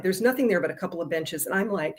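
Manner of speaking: ordinary talk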